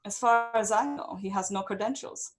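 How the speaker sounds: lightly voiced reluctant noblewoman; lady leader's proclamation. A narrator's reading. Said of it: "she says".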